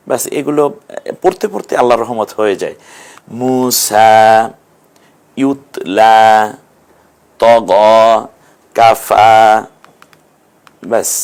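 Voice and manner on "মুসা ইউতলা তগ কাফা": each word's final vowel held long, like the "saa" of মুসা; full long vowels. Shown as a demonstration. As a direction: chant মুসা, ইউতলা, তগ, কাফা one by one, endings stretched. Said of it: "পূর্ণ উচ্চারিত হয়".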